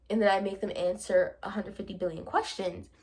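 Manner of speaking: normal talking voice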